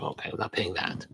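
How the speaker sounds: muttered refusal. Retold or imitated. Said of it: "I was like".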